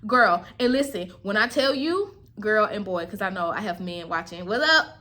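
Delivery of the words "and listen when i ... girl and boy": ordinary delivery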